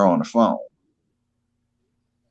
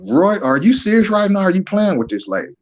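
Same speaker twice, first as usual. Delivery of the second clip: imitating surprise; 8 semitones higher than usual. Retelling Brenda's surprised question.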